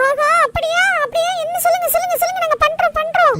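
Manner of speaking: high-pitched, tumbling repeated words; eager excitement. Acted. Boy offered his own Kinder Joy shop, eager excitement.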